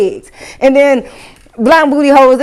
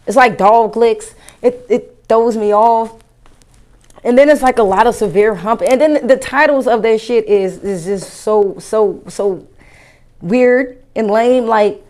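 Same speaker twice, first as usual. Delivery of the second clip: ordinary talk, nothing marked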